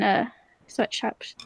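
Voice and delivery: drops to a slow whisper; hushed conspiratorial whisper; whispered aside. Real, not acted. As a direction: breathe the punchline under your voice. Whispered aside about feminist shirts.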